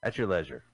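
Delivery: "leisure" said as LEZH-ur, low; "leisure" rhyming with measure; short-E leisure. Real, not acted. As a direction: repeat "leisure" obediently with a short e. Repeats the corrected pronunciation.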